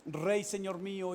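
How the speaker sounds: slow, deliberate, respectful answer; slow respectful reply to the king. Acted. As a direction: answer the king slowly and humbly. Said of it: "David respondió".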